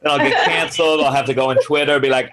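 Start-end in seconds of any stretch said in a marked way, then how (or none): none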